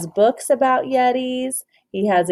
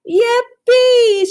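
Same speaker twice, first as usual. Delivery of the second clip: high, loud whoop of joy; excited shout; 13 semitones higher, 8 dB louder than usual. Acted. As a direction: shout it out, delighted. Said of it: "shouted George".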